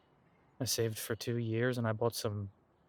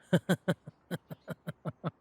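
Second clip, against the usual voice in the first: a run of light chuckles; laughing; 6 semitones higher, 3 dB louder than usual. Acted. Companion recalling wild bike days, light chuckling. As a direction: chuckle along at the memory. Laughing at his own story.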